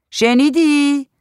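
high, loud, drawn-out call; insistent calling. A narrator's reading. Woman checks husband in other room heard, insistent high call. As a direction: call it out slowly, demanding an answer.

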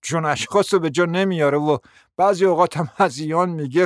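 normal talking voice

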